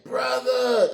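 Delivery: high, drawn-out call of recognition; retold glad call. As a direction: call out to a friend across a crowd, pitch way up. Retold greeting on spotting the speaker.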